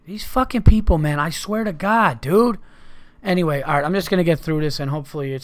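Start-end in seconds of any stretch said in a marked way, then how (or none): 0.1-2.6 louder, higher exasperated yell at the noisy neighbours; real angry outburst. Real, not acted. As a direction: vent it loudly at the people outside. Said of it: "I'm gonna fucking say something".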